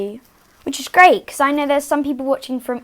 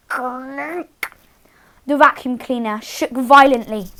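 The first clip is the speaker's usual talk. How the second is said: usual speaking voice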